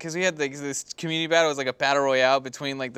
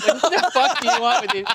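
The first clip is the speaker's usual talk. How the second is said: exasperated; 7 semitones higher, 3 dB louder than usual. Acted. Ranting about Brink kids.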